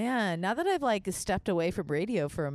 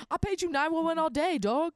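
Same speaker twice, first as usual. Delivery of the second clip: higher, cocky mock bro; 9 semitones higher than usual. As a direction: toss it off like a pushy bro. Mock pager message.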